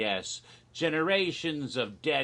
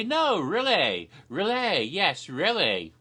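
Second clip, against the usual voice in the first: insisting; 6 semitones higher, 4 dB louder than usual. Retold or imitated. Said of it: "And I say".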